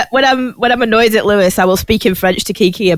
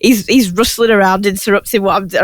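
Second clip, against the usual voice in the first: ordinary talk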